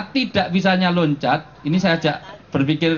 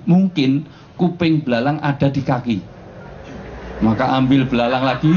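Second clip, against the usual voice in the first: ordinary delivery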